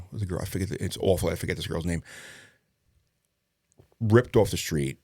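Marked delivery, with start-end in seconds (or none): none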